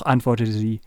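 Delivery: plain reading voice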